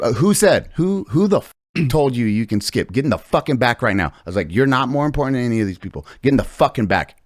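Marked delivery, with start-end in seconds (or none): 0.0-4.1 higher and louder demand; mock furious scolding of a line cutter; retold telling-off. 4.5-7.2 mock furious scolding of a line cutter; retold telling-off.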